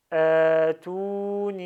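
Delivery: "Atouni" chanted slow and loud, all three vowels long; three prolonged vowels. Shown as a demonstration. Teacher demonstrates three lengthenings, "Atouni" with every vowel held. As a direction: stretch each vowel of "Atouni" loudly. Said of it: "j'ai trois prolongements ici".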